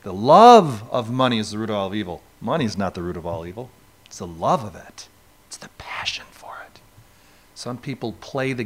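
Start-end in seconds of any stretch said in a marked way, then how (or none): 2.4-6.8 dropping to a low hush; hushed aside. Real, not acted. Preacher hushing the congregation on a key point. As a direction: lean in and almost whisper it.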